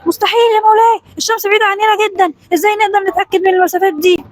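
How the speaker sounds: high-pitched heated objection; outraged. A narrator's reading. Vizier disputes the distances before the sultan, outraged. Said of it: "الوزير قال بثورة".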